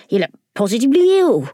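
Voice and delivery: quick worried grasshopper; fussy old grasshopper. Acted. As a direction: rattle it off, concerned. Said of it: "the old green grasshopper asked".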